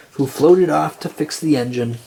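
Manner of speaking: usual speaking voice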